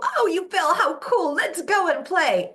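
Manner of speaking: put-on high, bright-sounding cheerful mom to a fallen toddler; breezy high delight, brushing off the fall; jolly mom. Mom cheering a fallen toddler on, high and loud. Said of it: "just being positive and jolly".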